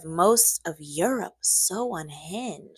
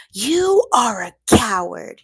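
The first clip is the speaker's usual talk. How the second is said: loud slow furious accusation; hammed-up angry emperor; screaming; 4 semitones higher, 9 dB louder than usual. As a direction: yell it in his face. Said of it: "he screamed in Talleyrand's face".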